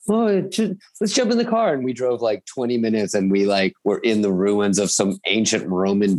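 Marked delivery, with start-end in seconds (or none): 0.1-1.7 much higher, breezy imitation of the mother-in-law; mother-in-law suggesting a quick drive, mock breezy high voice; chirpy mother-in-law. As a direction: lightly play her casual cheer. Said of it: "she was like".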